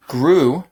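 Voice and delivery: grew said high and loud with a long "oo"; U sound in grew. Shown as a demonstration. Teacher models a word for pronunciation practice, grew with loud, high "oo". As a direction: hold the "oo" in grew, pushed out clearly. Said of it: "grew también tiene el U sound".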